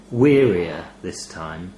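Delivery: ordinary delivery, nothing marked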